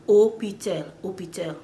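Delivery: "hôpital" starting straight on the O; French silent H. Shown as a demonstration. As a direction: skip the H in "hôpital". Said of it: "They skip the H".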